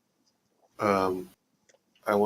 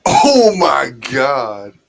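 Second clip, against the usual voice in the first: long, drawn-out yell; loud exclamation; 3 semitones higher, 11 dB louder, much slower than usual. Real, not acted. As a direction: belt it out, stretching every word. Player reacts to a dice roll.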